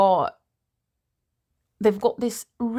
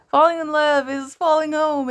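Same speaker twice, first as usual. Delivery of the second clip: high, loud theatrical reading; overdone poetic declamation; dramatic restart; 7 semitones higher, 6 dB louder than usual. Acted. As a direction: belt the line like a grand recital. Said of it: "restarting the poem after losing page".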